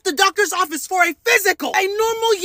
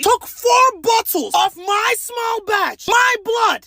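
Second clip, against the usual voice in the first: outraged shout; 5 semitones higher, slower than usual. Real, not acted. To the clinic, shouting about the blood taken. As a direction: build it up into a yell. Ranting over blood draw.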